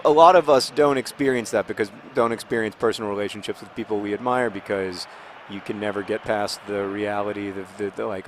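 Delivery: normal talking voice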